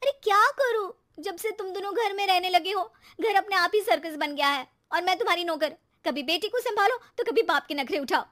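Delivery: high, loud venting; exasperated complaint. Acted. Wife venting at husband, loud exasperation. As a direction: pour out the frustration.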